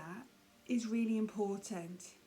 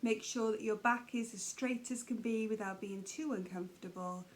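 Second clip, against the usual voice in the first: ordinary delivery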